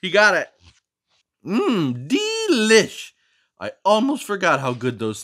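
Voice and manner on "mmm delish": savouring. Acted. Character savours snack.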